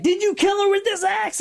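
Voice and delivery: high, theatrical mock interrogation; charging accusation. Lawyer running over with the axe, mock accusing. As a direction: demand an answer as a lawyer rushing in. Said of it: "And he runs over".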